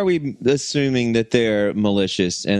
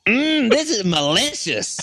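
savoring food voice; 8 semitones higher than usual. Acted. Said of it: "You sound like a, an angry Southern mechanic".

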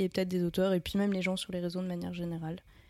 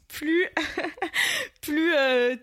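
giggling; 7 semitones higher, much slower than usual. Real, not acted. Giggling mid-sentence.

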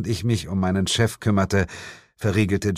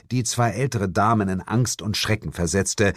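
plain reading voice

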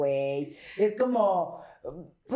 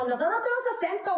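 fast, higher mocking voice of a chilango; mock smug chilango; 10 semitones higher, much faster than usual. Mocking chilangos who claim no accent, fast and high. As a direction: tease it like a know-it-all. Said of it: "es como".

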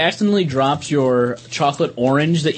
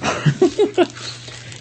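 high breathy laugh; 14 semitones higher than usual. Real, not acted. Laughing at a joke.

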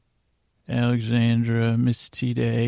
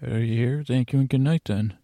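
ordinary delivery

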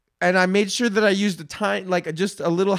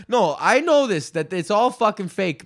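ordinary talk

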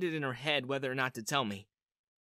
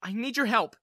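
higher, louder urgent plea; pleading in a raised voice; begging; 7 semitones higher, 5 dB louder than usual. A narrator's reading. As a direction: beg for help with real urgency. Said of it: "begged Sarita".

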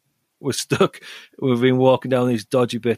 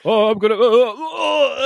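mock sex moans; 11 semitones higher than usual. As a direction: ham up a man moaning in bed. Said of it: "you could hear him".

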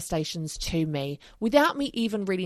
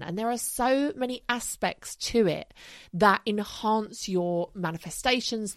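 ordinary talk, nothing marked

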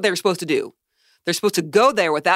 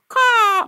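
high loud bird caw; crow caw; 5 dB louder than usual. Retold or imitated. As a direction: squawk like a crow. Imitating a crow call.

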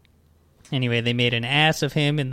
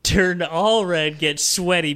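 ordinary talk

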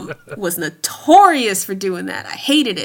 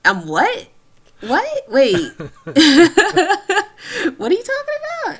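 jumping way higher, baffled and fast at the end; mock confused surprise; 11 semitones higher, 3 dB louder than usual. Mom reacting to a kid smelling themselves, baffled high shock. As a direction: play a stunned mom who can't believe it. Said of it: "'cause it's just like".

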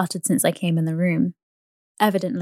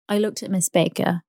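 ordinary delivery

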